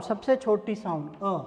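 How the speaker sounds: ordinary talk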